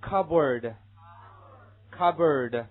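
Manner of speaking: 慢读示范，加重; slow pronunciation demo. Shown as a demonstration. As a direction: stretch out "cupboard" for the class. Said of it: "下面一个".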